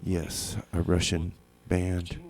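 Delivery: usual speaking voice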